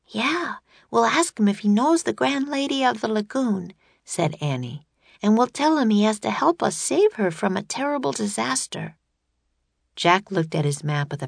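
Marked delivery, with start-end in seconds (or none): 0.1-3.7 high, lilting voice for Annie; eager Annie. A narrator's reading. Annie planning to ask the ruler about the Lady, eager high voice. 5.2-9.0 high, lilting voice for Annie; eager Annie. A narrator's reading. Annie planning to ask the ruler about the Lady, eager high voice.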